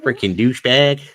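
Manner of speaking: hammed-up American accent, punched out loud; mock American accent. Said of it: "idea what your accent might be like".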